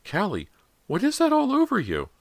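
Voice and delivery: very high, loud alarmed demand. A narrator's reading. Mother questioning her daughter about the mess, demanding. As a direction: shoot the pitch right up, demanding an answer. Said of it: "she demanded".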